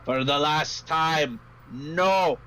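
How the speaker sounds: put-on slow, weary Gnomish accent; tired innkeeper in a light Gnomish accent. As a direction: drag out a fed-up Gnomish refusal. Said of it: "I suck at Gnomish accents".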